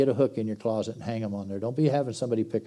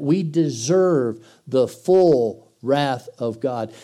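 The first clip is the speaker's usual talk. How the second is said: slow, weighty; 4 dB louder, much slower than usual. Shown as a demonstration. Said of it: "You need to understand the weight of that".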